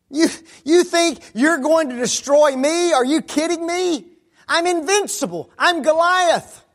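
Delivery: high, loud mocking giant's voice; scornful Goliath. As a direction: sneer down at the little shepherd boy. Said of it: "Goliath's response when he saw him".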